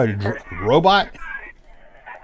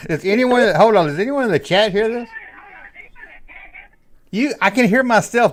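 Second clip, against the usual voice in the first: excited; 8 semitones higher than usual. Real, not acted. Host exclaims over robot echo.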